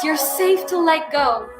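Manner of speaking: usual speaking voice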